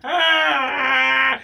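long, high imitated bawl; exaggerated crying howl; in-depth mock cry. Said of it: "that cry where it's in depth".

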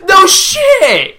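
loud, high, drawn-out mock outrage; exasperated sarcasm. Mocking the monster's owner, exasperated sarcastic yell. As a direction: yell it slowly as a scornful retort. Sarcastic retort to the character's panic.

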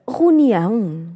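reunião drawn out slowly with the e clearly sounded; slow correct reunião. Shown as a demonstration. Speaker insisting that reunião has an e, slow and deliberate. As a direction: spell it out slowly: re-u-nião. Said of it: "tem lá um E".

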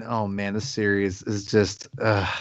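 low, slow, fed-up sigh of a line; exasperated groan. Retold or imitated. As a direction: let the disappointment drag out low and slow. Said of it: "I just keep, like, going".

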